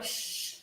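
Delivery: soft, drawn-out shush; hushed "shh"; hushing. Acted. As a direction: shush someone to catch a tiny sound. Hushing wife while super focused.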